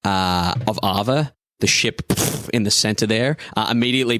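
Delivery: usual speaking voice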